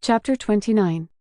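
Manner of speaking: plain reading voice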